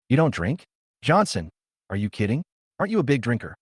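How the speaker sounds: ordinary delivery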